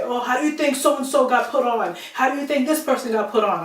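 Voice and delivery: mock his annoyed challenge; imitating mad producer. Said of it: "he gets mad at me and say".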